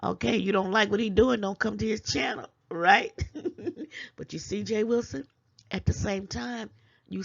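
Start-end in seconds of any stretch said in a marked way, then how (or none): 3.2-4.1 breathy laugh. Real, not acted. Laughs after a jab.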